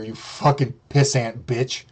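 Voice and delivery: ordinary delivery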